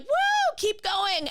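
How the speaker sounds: very high, whooping crowd cheer; mock audience whoop. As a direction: hoot it like a rowdy fan in the audience. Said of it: "some people are like".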